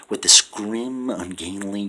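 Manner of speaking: plain reading voice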